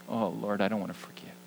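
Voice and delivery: soft, grudging protest; a quiet reluctant plea; reluctant murmur. Pretend. His reluctant reply to God.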